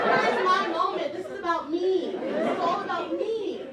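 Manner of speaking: put-on child whining that attention went elsewhere; petulant whine. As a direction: demand the spotlight like a spoiled kid. Self-centered whine.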